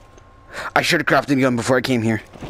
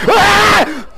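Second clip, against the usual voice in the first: short high scream; startled scream; 13 semitones higher, 6 dB louder than usual. Real, not acted. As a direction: cry out at a scare. Jump scare.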